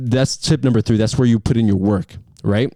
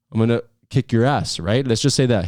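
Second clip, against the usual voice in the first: ordinary talk, nothing marked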